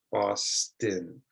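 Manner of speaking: Boston said with a reduced second vowel, falling; model word. Shown as a demonstration. Teacher models Boston for students. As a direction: say Boston clearly, soft last syllable. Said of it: "make the I sound".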